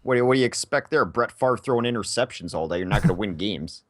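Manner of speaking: mock Upper Midwest football fan; Minnesota accent. Fan complaining about Brett Favre's interceptions, Minnesota accent. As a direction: grumble in a broad Minnesota accent. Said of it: "that's a pretty good Minnesota one".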